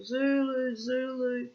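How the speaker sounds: high, loud worried calling out a name; anxious yelling for a missing pet; calling the cat. Retold or imitated. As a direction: call out across the street for a lost cat. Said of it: "I used to scream and call".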